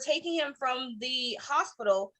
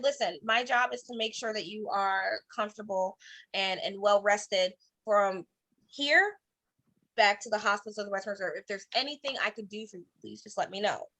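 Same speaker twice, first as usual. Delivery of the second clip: reassuring; 6 semitones lower, 5 dB quieter than usual. Retold or imitated. EMT reassuring patient.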